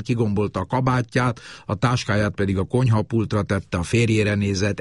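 normal talking voice

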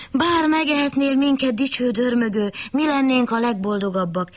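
high, sugary flattery; butter up the bear, voice lifted high; wolf sweet-talking the bear, high and fawning; flattering wolf. Acted. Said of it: "hízelkedett a farkas".

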